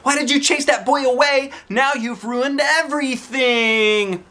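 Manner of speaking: put-on high angry girl, slowing at the end; high upset kid voice; hollering. A narrator's reading. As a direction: scold them for ruining it. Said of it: "she hollered".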